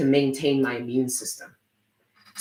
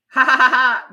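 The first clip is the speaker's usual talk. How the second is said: loud, higher burst of laughing; loud laughter; 11 semitones higher, 9 dB louder than usual. Real, not acted. Streamer cracking up at her chat, loud laughter. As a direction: crack up for real.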